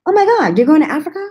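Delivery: high, loud, thrilled friend; mock friend squealing with excitement; excited squeal. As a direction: squeal it like big news. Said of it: "she's like".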